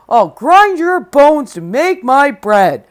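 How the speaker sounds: loud, slow giant threat; booming giant. Acted. Giant threatening Jack, booming. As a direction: thunder the threat slowly. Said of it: "stop talking in uppercase letters".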